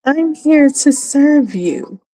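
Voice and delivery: simpering eager servant; mocking women who serve such men; mock servile woman. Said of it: "they're like".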